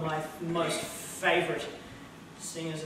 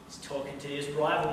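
ordinary talk

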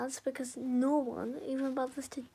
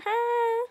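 loud, high single mocking laugh; 9 semitones higher, 10 dB louder than usual. Taunting imagined bullies, a loud mocking ha. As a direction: bark one triumphant ha.

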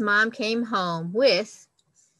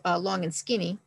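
ordinary delivery

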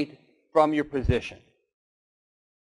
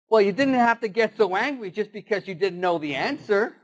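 higher, lightly voiced wounded lion; sulky lion; 8 semitones higher than usual. Beaten lion grumbling at the elephant, higher and sulky. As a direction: act like a battered lion still saving face.

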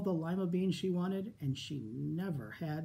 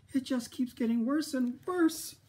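near sobbing; 8 semitones higher than usual. Acted. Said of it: "she began to sob".

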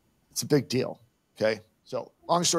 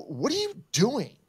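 much higher exasperated challenge; confronting again; 11 semitones higher than usual. Retold or imitated. Golfer repeating his challenge to the lady, higher exasperated. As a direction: press her, more worked up. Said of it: "And I go".

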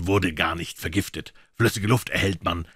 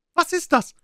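much higher, louder startled question; quick sharp question; 6 dB louder than usual. A narrator's reading. Mister Fair asking about the liquid, sharp and quick. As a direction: snap the question out. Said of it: "fragte Mister Fair schnell".

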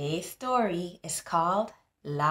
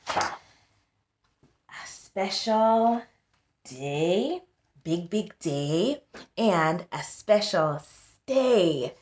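special and stay drawn out slowly; rhyme stressed; much slower than usual. Shown as a demonstration. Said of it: "these two words that they sound the same".

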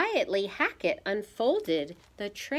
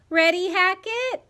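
put-on eager child; 12 semitones higher, 7 dB louder than usual. A narrator's reading. Ivy voiced, asking her brother.

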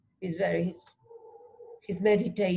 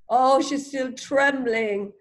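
ordinary talk

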